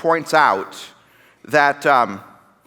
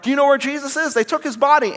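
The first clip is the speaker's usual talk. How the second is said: anxious asking; 10 semitones higher than usual. Acted. Upset Mary asking the gardener.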